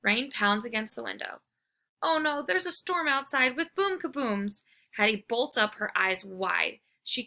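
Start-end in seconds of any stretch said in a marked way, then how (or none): 2.0-4.6 scared. Acted. Frightened girl in storm.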